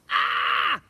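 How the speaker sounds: high, loud fright scream. A narrator's reading. Twins startled by boy, loud scream. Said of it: "The twins screamed".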